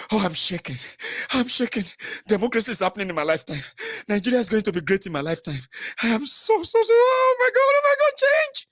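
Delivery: rising to a very high, fast squeal; mock ecstatic. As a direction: build into giddy hysteria.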